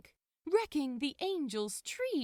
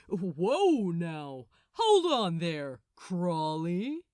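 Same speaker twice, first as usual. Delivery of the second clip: loud, mocking hold-on; smug Gabriel; 3 semitones lower, 8 dB louder, slower than usual. A narrator's reading. Gabriel calming a battle-ready Crowley, loud and mocking. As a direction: tease him while backing off.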